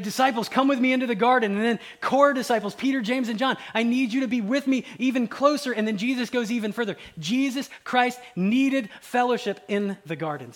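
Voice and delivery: high, loud, urgent sermon delivery; impassioned preaching. Acted. Preacher telling of Jesus calling disciples into the garden, impassioned.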